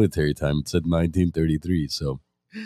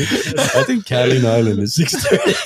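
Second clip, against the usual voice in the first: laughing; 11 semitones higher than usual. Real, not acted. Joke about time zones.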